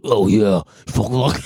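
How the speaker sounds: low growly goblin impression. Podcaster playing rapper on first album, low goblin voice. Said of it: "goblin voice from fucking his first album".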